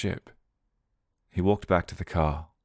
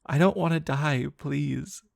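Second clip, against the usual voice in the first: high, terrified begging; terrified pleading; 9 semitones higher than usual. A narrator's reading. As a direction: plead for your life. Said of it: "like a deer trapped in headlights".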